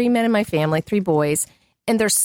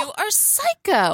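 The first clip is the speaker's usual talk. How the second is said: husband teasing; 11 semitones higher, slower than usual. Retold or imitated. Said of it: "He goes".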